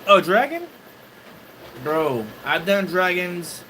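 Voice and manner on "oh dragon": surprised. Real, not acted. Reacts to a viewer's comment.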